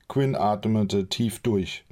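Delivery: ordinary delivery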